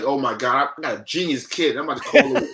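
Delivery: acting out a dad thrilled with his kid; amazed proud father. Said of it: "Now he's like".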